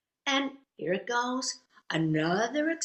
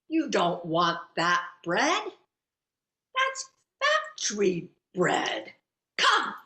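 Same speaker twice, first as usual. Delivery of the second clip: emphatic, weighty warning ending in a loud command; deliberate word-by-word scolding, then a sudden order; bossy stranger; 4 dB louder, slower than usual. Retold or imitated. Woman in store steering shopper off factory bread, bossy and emphatic.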